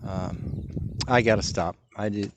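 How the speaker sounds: ordinary talk, nothing marked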